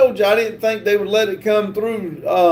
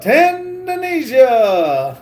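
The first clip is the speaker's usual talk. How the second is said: long, drawn-out call, pitched up; playful shout-out; 7 semitones higher, much slower than usual. Pretend. Reading a viewer's shout-out request, stretched-out holler. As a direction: holler it, holding the first syllable. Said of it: "can you shout out".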